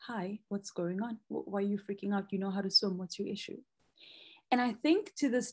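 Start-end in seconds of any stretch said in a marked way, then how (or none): 0.1-3.6 softer, calm inner voice; calm self-talk. Retold or imitated. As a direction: talk yourself down gently from panic. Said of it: "there's a self-to-self discussion".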